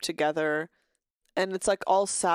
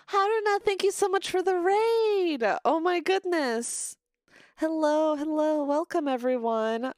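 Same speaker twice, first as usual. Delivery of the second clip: much higher, delighted thank-you; excited raid thanks; 8 semitones higher than usual. Real, not acted. Streamer thanking Haruna for the raid, high and excited. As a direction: gush over the surprise raid.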